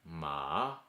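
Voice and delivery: "ma" dipping low, then rising; third tone. Shown as a demonstration. Teacher demonstrating ma for horse, dipping tone. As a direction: draw a check mark with "ma". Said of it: "let's do them all together".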